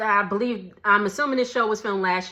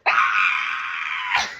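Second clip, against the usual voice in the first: mock scream; 12 semitones lower than usual. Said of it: "she's like".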